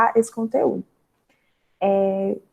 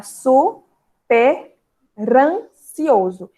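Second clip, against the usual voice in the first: "superancioso" broken into su, pe, ran, cioso; syllable division; much slower than usual. Shown as a demonstration. Teacher shows how it divides, syllable by syllable. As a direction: pause between the syllables of "superancioso". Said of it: "que vai ficar".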